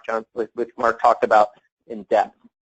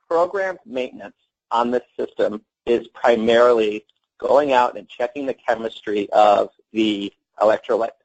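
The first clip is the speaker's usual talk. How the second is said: ordinary delivery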